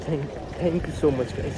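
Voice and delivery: drawn out with real gratitude; heartfelt thanks. Real, not acted. Grateful thanks to viewers. (ધીમેથી, દિલથી આભાર)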